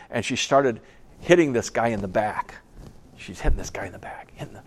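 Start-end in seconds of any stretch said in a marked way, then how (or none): 3.2-4.6 hushed, breathy retelling; whisper. A narrator's reading. Preacher whispering the story's climax to his congregation. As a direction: drop to a secret whisper to pull them in.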